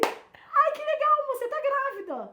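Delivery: imitating husband. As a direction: ham up his oblivious delight, high and loud. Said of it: "Aí ele". (imitando o marido, agudo e exagerado)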